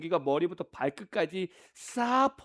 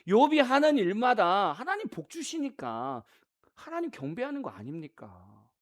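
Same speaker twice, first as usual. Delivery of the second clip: scoffing devil; 3 semitones higher than usual. Acted. Devil challenging God about Job's faith, scoffing. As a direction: scoff as the devil, stretching the words. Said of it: "마귀가 뭐라고 해요?".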